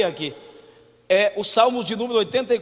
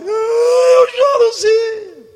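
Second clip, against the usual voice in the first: high, slow, loud wail; mock wailing widow; 12 dB louder, much slower than usual. Widow answers Jesus at her son's burial in a wail. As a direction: wail it out, hammed-up grief.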